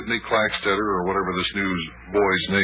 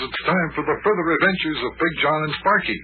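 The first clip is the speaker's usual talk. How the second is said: put-on kids show host; 6 semitones higher, slower than usual. Announcer introduces today's chapter.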